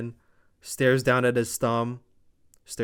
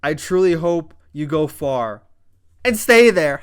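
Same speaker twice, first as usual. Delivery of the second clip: roasting quote; 3 semitones higher, 6 dB louder than usual. Retold or imitated. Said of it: "And then he s-, says".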